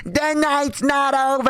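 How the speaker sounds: high, slow, drawn-out taunt; mock yelled taunt. Nico yelling from his car as he drives off, high and slow. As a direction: drag out every word like a parting threat. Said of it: "We just continue referencing".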